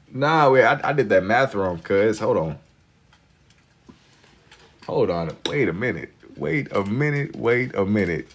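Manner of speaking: normal talking voice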